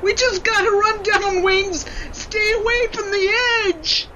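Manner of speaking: pleading near tears; frightened warning. Acted. Sled racing down icy slope.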